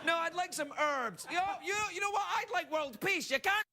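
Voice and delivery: high comic rant building to a shout; carried-away mock tirade. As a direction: get carried away, voice climbing to a yell. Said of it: "I got carried away".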